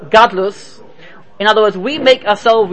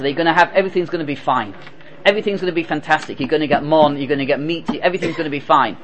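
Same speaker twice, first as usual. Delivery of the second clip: softer, lower, quick soothing assurance; calm reassurance; 5 semitones lower, 6 dB quieter, faster than usual. Acted. Moshe assuring the frightened people, softer and calm. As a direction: settle their fears gently.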